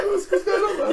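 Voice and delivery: high mock squeak through laughter; voice breaking. Man trapped in his car greets the big stranger, voice breaking high. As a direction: act out your voice cracking upwards. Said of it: "Literally, my voice broke".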